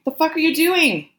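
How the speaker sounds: high-pitched alarmed demand; high, distressed outburst; anguished protest. A narrator's reading. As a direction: protest in panic, voice way up. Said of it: "Gabrielle's anguished protest filled the gallery".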